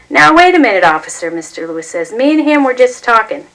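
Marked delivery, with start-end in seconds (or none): 0.1-1.3 higher, louder urgent objection; loud protest. A narrator's reading. Neighbor protesting to the police officer, loud urgent objection. 2.1-3.5 higher and louder insistence; pleading protest. A narrator's reading. Neighbor defending father to the police, raised insistence.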